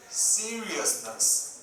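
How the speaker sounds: very slow. Shown as a demonstration. Teacher reading a definition word to class, very slowly. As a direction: stretch the word out slowly, syllable by syllable. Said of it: "I will read it very slow".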